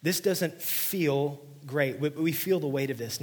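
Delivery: preaching hard. Real, not acted. Preaching at full force.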